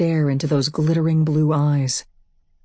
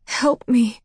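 urgent plea; 7 semitones higher than usual. A narrator's reading. Asher pleads for help.

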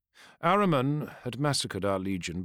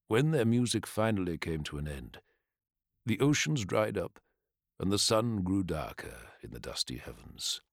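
fireside storytelling; 3 semitones lower, 3 dB quieter than usual. Acted. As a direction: tell it like an old legend by the fire. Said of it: "in his storyteller's voice".

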